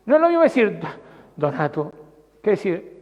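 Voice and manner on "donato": a low, understated "Donato"; low-toned name. Shown as a demonstration. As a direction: say "Donato" low and softly level. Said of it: "El tono de voz".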